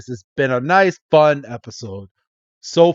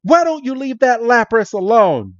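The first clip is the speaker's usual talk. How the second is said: higher, louder call across the beach; 9 semitones higher, 3 dB louder than usual. Retold or imitated. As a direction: shout at the bullies to stop. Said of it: "they're like".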